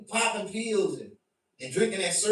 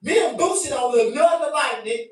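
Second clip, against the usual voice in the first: indignant denial; 7 semitones higher, 4 dB louder than usual. Retold or imitated. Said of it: "I'm like".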